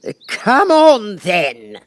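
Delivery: very high, loud, slow roar; roaring Queen. A narrator's reading. The Queen summoning Alice, a high loud roar. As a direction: roar it like a command to the whole court. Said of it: "roared the Queen".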